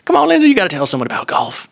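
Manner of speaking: hammed-up Southern coaxing, voice pushed up high; Southern accent. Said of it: "I'll probably bust out the Southern accent".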